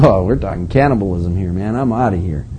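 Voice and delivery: a lightly voiced put-off listener backing away; mock recoil, a touch of disgust; disgusted walkout. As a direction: play the shocked listener who's done with this teaching. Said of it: "You're just going".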